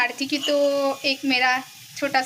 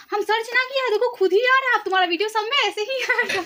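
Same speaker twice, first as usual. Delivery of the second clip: higher mock dad voice, amazed; imitating amazed dad; 9 semitones higher than usual. Dad tells daughter her video appears unsearched, higher amazed mock voice. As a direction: play the dad marvelling his daughter pops up. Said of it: "तो बोले".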